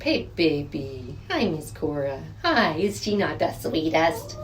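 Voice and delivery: low, soft and slow cooing; hushed tender pet talk. Real, not acted. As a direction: murmur sweetly to the hen. Softly greeting the hen.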